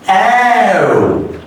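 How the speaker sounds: ow drawn out with a wide open mouth; stretched ow. Shown as a demonstration. Teacher isolating the vowel, exaggerated. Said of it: "O U is pronounced".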